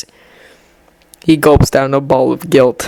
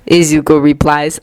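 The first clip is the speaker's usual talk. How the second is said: usual speaking voice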